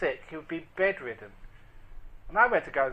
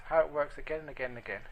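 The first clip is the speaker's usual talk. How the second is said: usual speaking voice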